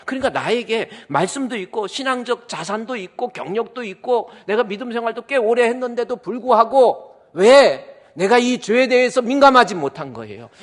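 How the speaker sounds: ordinary delivery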